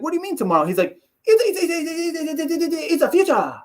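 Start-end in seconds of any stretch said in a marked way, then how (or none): 0.0-0.7 higher-pitched imitated question; puzzled interviewer. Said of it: "he's like, he's like". 1.2-3.5 high, stuttering falsetto imitation; Yoel Romero. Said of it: "He says it's, like, a thousand times".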